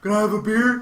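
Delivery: loud, high casual question; imitating the friend. The special friend asking the couple, lightly mimicked clueless voice. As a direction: ask it like the clueless guy. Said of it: "he's like".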